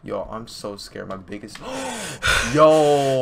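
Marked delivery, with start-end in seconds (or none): 1.6-3.2 high loud startled yelp; startled gasp. Real, not acted. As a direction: jump and yelp.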